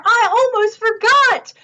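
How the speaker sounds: high, loud dismayed cry; loud high fluster. Acted. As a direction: wail it as he remembers. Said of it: "cried the farmer".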